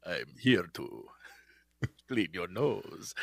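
put-on very low, hushed, slow accented menace; vampire-like bee. Host playing the nose-cleaning bee, vampire voice. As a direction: intone deep and slow like a vampire. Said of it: "It sounds like a vampire".

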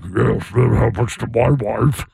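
low, slow, disguised voice; deep, drawn-out masked villain voice; Darth Vader. Acted. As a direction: imitate a voice changer on a nervous caller. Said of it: "So we can change your voice".

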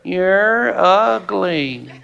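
very slow, drawn-out parrot voice; talking parrot. Acted. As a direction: stretch each word out like a taunting parrot.

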